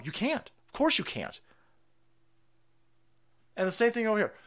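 ordinary talk, nothing marked

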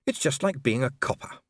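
ordinary delivery, nothing marked

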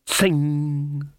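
ringing metallic "tsing"; sustained blade-like zing; imitated claw noise. A narrator's reading. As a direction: sound out claws sliding out, holding the ring. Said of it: "make a noise like".

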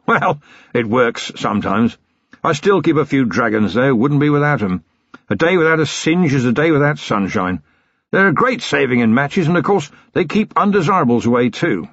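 put-on cheerful old military man holding forth; bluff old colonel. A narrator's reading. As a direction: play a jovial retired colonel reminiscing. Colonel continues chatting.

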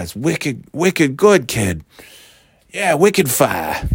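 hammed-up Boston accent, drawn out at the end; New England accent. Mocking locals who say wicked all the time, Boston accent. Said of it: "everybody says wicked all the time".

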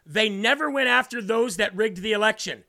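normal talking voice

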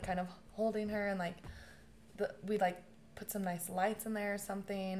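usual speaking voice

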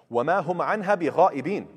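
Quran recitation. Acted. Teacher reciting the verse to students, chanted and fast.

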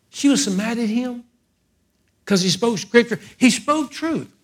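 preacher soaring on the truth; high, ringing preaching. Acted. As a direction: pitch soaring up as the point lands.

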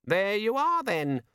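high, forced-cheery imp; high-pitched little demon, faltering at the end; demon with draining enthusiasm. A narrator's reading. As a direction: keep up the perkiness as it slips. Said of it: "trying to hold on to its rapidly draining enthusiasm".

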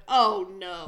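loud, comic "oh no" of dread; loud mock dismay. Alone in reacting to the Apple logo, loud mock dismay. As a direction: blurt it out in exaggerated dismay.

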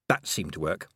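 usual speaking voice

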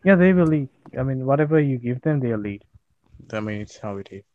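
usual speaking voice

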